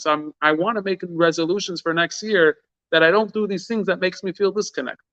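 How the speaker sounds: rising fervent preaching. Acted. Fervent climax of the sermon.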